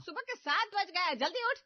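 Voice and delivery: high, loud wake-up call. Acted. Mother-in-law yelling to wake the sleeping bride. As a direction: rouse her from bed with a shout.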